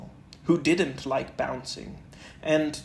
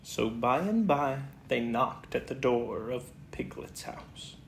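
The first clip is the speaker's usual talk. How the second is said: usual speaking voice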